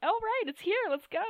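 high, bright eager cheer. Retold or imitated. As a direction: squeal it like the wait is over. Retold eager self-quote.

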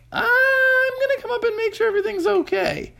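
very high mother voice; a mom announcing she is coming up; high-pitched mother, firm. A narrator's reading.

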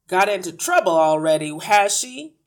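very loud, accusing question; brusque father. A narrator's reading. Father blocking the doorway to the teacher, brusque. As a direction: challenge the teacher loudly.

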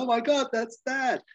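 high-pitched acted-out shock; mock alarm. Rusty seeing his family crash in, high mock alarm. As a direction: retell a startled cry, pitched high.

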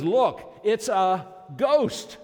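high, loud, slowed-down ghost alarm; hammed-up fearful shout, drawn out; frightened cry. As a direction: cry out in terror at a shape on the water. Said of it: "the third description of the problem".

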